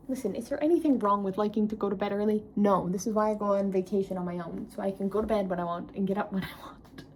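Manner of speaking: normal talking voice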